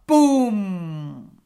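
long, loud drawn-out boom; slow, resounding storybook boom; booming bang. A narrator's reading. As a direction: stretch the word into a loud explosion. Narrator imitates the tire bursting.

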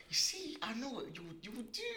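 hushed teasing whisper. Acted. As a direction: breathe it out in a whisper. Teasing his friend's spending.

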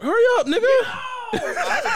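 high, slow yell through laughter; laughing shout. Real, not acted. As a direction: holler at him while cracking up. Rushing friend, laughing shout.

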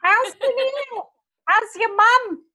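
very high Indian-Australian aunt impression; funny aunt voice. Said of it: "she's got a really funny voice too".

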